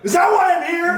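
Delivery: high, loud hammed-up yell; mock outraged shout. Comic to host, mock outraged shout. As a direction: bellow it in fake indignation.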